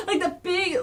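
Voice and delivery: usual speaking voice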